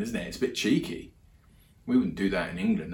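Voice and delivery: normal talking voice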